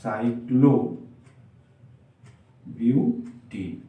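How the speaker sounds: very slow, syllable by syllable with long gaps; drawn-out dictation. Real, not acted. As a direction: say it slowly as you write. Saying the name while writing it.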